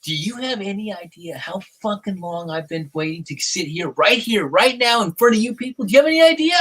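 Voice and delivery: indignant build, pitch climbing high; worked-up, insistent retold challenge; adamant. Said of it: "I was just like adamant".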